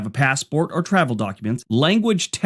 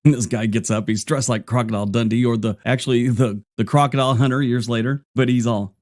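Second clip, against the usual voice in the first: ordinary delivery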